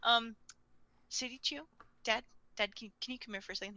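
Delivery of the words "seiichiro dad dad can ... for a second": hushed aside. Acted. L quietly calls Dad over for a word, hushed. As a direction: drop right down and ask discreetly.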